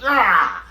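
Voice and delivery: loud, high imitated battle cry; mock attack yell.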